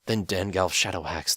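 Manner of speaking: usual speaking voice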